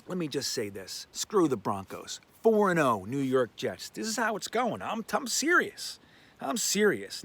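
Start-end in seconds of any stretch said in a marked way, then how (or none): 3.9-7.2 high-pitched, emphatic insistence; insistent protest. Real, not acted. Fan defending an undefeated prediction, high-pitched insistence. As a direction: pitch it up, demanding to be believed.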